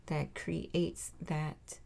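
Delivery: normal talking voice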